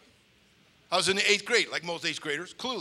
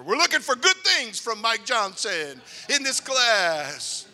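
slow, loud, higher teacher proclamation; booming teacher; 7 semitones higher, 10 dB louder, slower than usual. Retold or imitated. Teacher welcomes new student with slow booming praise. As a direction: announce it slowly and grandly. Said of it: "He goes".

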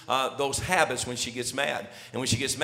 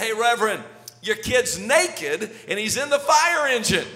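raised, higher-pitched alarmed holler; urgent neighborly shout, acted out; neighbor calling out; 6 semitones higher, 4 dB louder than usual. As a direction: call out like a startled neighbor.

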